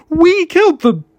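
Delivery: high, loud, guilt-stricken cry; anguished shout. A narrator's reading. David shouting the truth at his indifferent co-workers. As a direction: hurl the confession at them.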